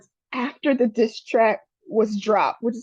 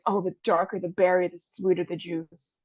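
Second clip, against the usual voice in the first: mock sultry purr; 5 semitones lower, 5 dB quieter than usual. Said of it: "I have three months to live".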